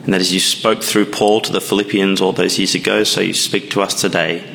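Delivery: low, hushed prayer voice. Acted. Opening prayer before the sermon.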